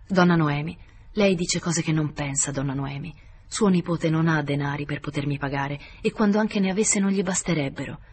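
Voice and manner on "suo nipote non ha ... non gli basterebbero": ordinary delivery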